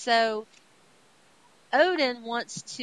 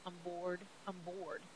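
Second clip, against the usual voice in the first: bored whine; 3 semitones lower, 7 dB quieter than usual. Retold or imitated. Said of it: "Thor's just sitting around going".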